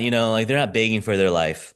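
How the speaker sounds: normal talking voice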